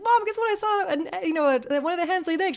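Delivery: put-on high, fast eager kid's voice; little girl telling mom about the egg; excited child. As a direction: blurt out the big news.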